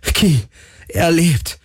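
very high, elated cries; exult, pitch leaping up; jubilant. A narrator's reading. Galen tells Ki that he lives, jubilant. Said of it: "jubelte Galen".

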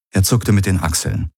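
ordinary delivery, nothing marked

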